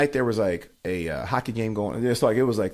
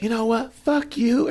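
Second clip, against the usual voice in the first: much higher-pitched mock female retort; imitated irate heckler; 12 semitones higher, much slower than usual. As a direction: play the heckler squealing back. Heckler curses the comic, retold.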